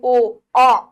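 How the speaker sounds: rounded ओ, then loud open आ; ओ and आ sounded separately, loudly; vowel contrast. Shown as a demonstration. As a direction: go from small ओ to wide आ. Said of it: "बड़ा मुंह".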